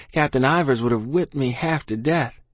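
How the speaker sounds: usual speaking voice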